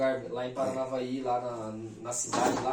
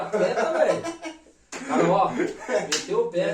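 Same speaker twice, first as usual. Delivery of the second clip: laughing it out loud, voice breaking up; 4 semitones higher, 10 dB louder, slower than usual. Real, not acted. Telling a podcast host about being outrun, laughing. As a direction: burst out laughing at your own story.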